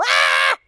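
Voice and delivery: short rough shout of fright; acted-out yell of alarm; mock startled scream. Man re-enacting fright at leaping snake, startled scream.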